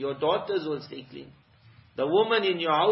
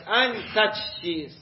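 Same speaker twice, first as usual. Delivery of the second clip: ordinary talk, nothing marked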